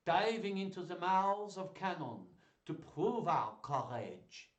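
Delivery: slow, hammed-up French accent. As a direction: declaim grandly in a put-on French accent. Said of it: "That was my French accent".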